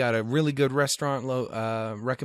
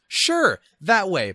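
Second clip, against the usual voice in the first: much higher, louder chirpy answer; cheerful local; 8 semitones higher, 6 dB louder, much slower than usual. Retold or imitated. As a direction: chirp it out confidently wrong. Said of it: "local gives directions".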